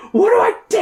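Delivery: high panicked scream. Acted. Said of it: "Kate screams".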